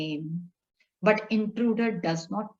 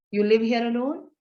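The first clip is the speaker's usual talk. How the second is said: rising question tune on "You live here alone?"; questioning inflection. Shown as a demonstration. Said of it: "here is a question mark".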